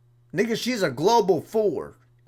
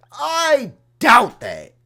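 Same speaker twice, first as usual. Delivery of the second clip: scoffing disbelief; 6 semitones higher, 7 dB louder, much slower than usual. Real, not acted. Scoffing at viewer counts.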